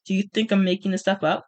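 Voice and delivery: ordinary delivery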